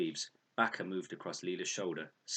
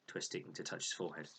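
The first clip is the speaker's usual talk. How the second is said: plain reading voice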